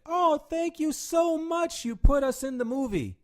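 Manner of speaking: high, slow, hammed-up thank-you voice; mock the grateful audience, drawn out and high; mock grateful fan.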